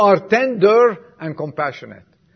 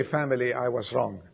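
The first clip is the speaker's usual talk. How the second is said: humble apology; 6 semitones lower, 10 dB quieter than usual. Retold or imitated. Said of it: "to say".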